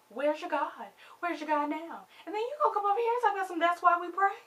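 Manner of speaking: high, loud, scornful challenge; mock confrontation. Acted challenge, mocking Dawn's quote.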